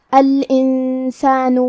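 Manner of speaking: الانسان with the noon hidden and nasalized; ikhfa with ghunna. Shown as a demonstration. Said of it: "نون ساکن کو چھپاتے ہوئے اور غنہ کے ساتھ". (الانسان میں نون کا اخفاء غنہ کے ساتھ)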